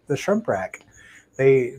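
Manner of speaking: normal talking voice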